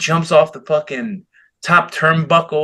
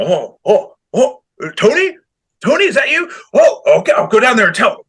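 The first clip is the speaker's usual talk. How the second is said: high, flustered imitation of Tony Schiavone, much higher; flustered announcer; 8 semitones higher, 5 dB louder than usual. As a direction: squeak it out like a rattled old announcer.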